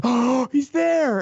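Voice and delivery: high, drawn-out awestruck gasp; mock kid excitement. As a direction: gasp like a thrilled little fan. Kids thrilled as favorite character appears.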